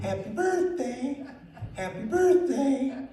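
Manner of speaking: high, sing-song parrot greeting. Retold or imitated. Parrot greeting the sister on her birthday. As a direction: chirp it like a talking bird. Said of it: "she got a parrot that said".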